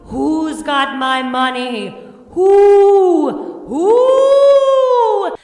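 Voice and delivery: very high, loud, slow ghost shriek; moaning ghost demanding her money; ghostly wail. Acted. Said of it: "the ghost of the dead woman cried".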